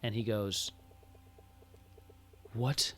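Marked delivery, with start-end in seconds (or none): 2.5-2.9 low, slow, quiet demand; hushed dread. Acted. Father spots the ring on his daughter's hand, hushed and grave. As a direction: draw it out softly, dreading the answer.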